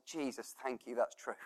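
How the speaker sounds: very soft, near-whispered awe; hushed prayer. Pretend. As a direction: murmur it like private prayer.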